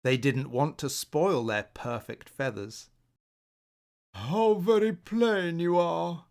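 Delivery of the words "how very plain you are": slow, scornful groan; groaning disdain. Acted. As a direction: groan it down at him slowly. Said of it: "groaned the green".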